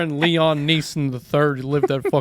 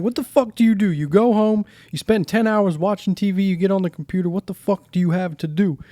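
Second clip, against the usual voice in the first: imitating Joey yelling; 6 semitones higher, 3 dB louder than usual. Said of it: "And he's like".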